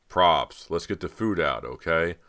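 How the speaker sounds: loud call to the back; shouting. Acted. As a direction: shout across the set to props. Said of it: "He turned toward the back and shouted".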